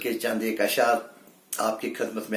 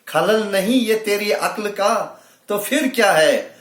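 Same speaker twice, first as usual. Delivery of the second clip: high, climbing mushaira declamation; raised, emphatic Urdu verse delivery; poetry recitation; 8 semitones higher, 6 dB louder than usual. Acted. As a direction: pitch the verse up to the audience. Declaims couplet, repeats it higher.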